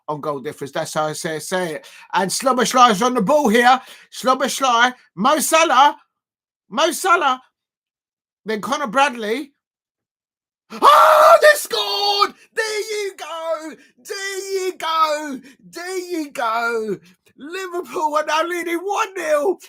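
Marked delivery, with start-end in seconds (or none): 2.1-9.5 raised, louder match commentary; building excitement. Real, not acted. Fan calling his team's attack live, building excitement. 10.7-19.6 high, explosive scream into drawn-out cheers; goal celebration. Real, not acted. Fan watching Liverpool score against Brighton, real ecstatic shouting.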